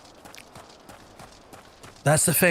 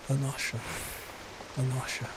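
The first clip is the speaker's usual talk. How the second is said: softly practising the name; 3 semitones lower, 14 dB quieter than usual. Real, not acted. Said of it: "me trying to figure out how to say".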